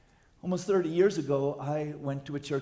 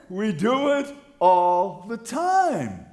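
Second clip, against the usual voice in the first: much louder, slow shouted refrain; preached shout; 5 semitones higher, 11 dB louder, much slower than usual. Acted. Pastor telling the church they gossip all the time, slow shout. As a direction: shout it slowly as the climax.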